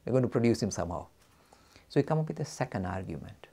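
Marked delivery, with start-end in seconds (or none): 1.9-3.5 a soft whispered aside. Real, not acted. Teacher confiding the next step, whispering. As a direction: lean in and whisper it.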